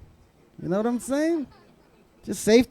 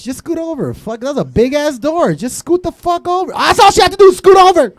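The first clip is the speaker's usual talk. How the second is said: building to a loud, high yell; exasperated rant; 8 semitones higher, 6 dB louder than usual. Real, not acted. Comic ranting at Rose about the door, building exasperation. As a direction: get more fed up with every line.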